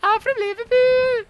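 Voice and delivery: very high, loud Scouse caricature; Liverpool accent. Acted. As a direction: squeal out an over-the-top Scouse accent. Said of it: "is that accent made up?".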